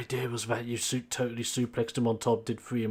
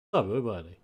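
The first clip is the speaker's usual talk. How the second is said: ordinary talk, nothing marked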